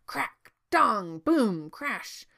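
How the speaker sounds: loud, punched crashing words; dramatic bangs, one by one; booming sound effects. A narrator's reading. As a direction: hit each word like a thunderclap. Narrator voices the genie's thunderous arrival.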